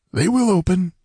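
high character voice, reassuring; assuring his allies. A narrator's reading. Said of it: "he assured his allies".

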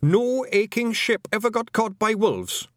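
high, panicky Scots insistence; gabbled protest. A narrator's reading. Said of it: "Rob Anybody gabbled".

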